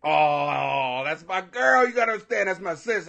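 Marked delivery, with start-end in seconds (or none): none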